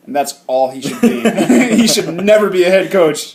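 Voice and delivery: loud, laughing rant. Real, not acted. Laughing rant about bad coach.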